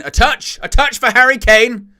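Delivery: high, loud commentator yell at a sudden chance; excited shout. Real, not acted. Excited shout at a live match.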